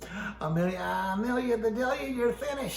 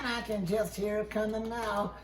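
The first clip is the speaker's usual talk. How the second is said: ordinary delivery, nothing marked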